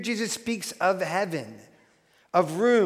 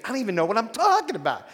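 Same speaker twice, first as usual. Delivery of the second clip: 1 Costello line; 4 semitones higher, 4 dB louder, much faster than usual. Retold or imitated. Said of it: "he goes".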